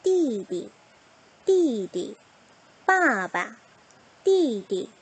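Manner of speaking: 띠디 and 빠바 with a loud first syllable and a light, dropped second; same falling pattern. Shown as a demonstration. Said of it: "높낮이가 같더라". (띠디, 빠바를 같은 높낮이로, 둘째 음절은 가볍게)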